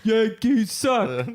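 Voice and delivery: put-on slow New York accent jeer; drawn-out New York heckle. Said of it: "doing a New York accent".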